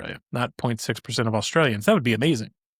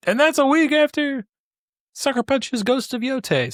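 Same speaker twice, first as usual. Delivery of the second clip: peak excitement; 13 semitones higher than usual. Real, not acted. Podcaster reaching the biggest release, thrilled and much higher. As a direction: hit the last release date at full excitement. Climax of stacked release dates.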